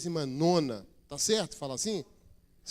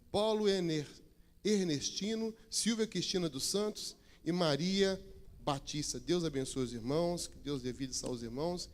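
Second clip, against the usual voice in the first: usual speaking voice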